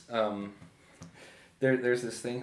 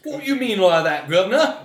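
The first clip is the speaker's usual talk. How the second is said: playful stock-Cockney, pitched up, loud, through a laugh; mock British accent; 9 semitones higher, 13 dB louder than usual. Teasing friend after a jab at Americans, mock British accent. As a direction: tease the friend as a cartoon Brit, laughing. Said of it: "That's called me".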